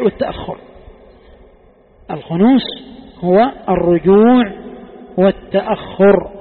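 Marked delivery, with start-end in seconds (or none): 2.1-6.3 slow defining emphasis. Shown as a demonstration. Teacher defining the word for students, slow and stressed. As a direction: dictate the definition slowly.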